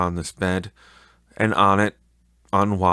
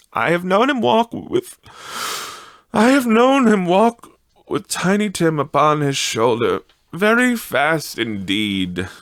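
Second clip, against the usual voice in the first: high, louder fond mother; high mother's voice; 9 semitones higher, 5 dB louder than usual. Acted. The mother remembering father carrying Tiny Tim, high and fond. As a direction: lift it high with pride.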